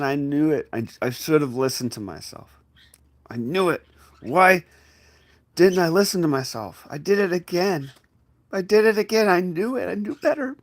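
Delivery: high, loud frustrated wail at yourself; exasperated self-reproach. Acted. Berating yourself for not listening, frustrated and climbing. Acted regret, repeated.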